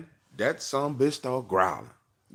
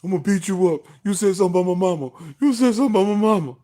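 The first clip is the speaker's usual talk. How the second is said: high, loud tearful threat; hammed-up bawling kid, rushing the words; crying kid after a fight; 10 semitones higher, 9 dB louder, faster than usual. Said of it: "been in a fight and you're crying".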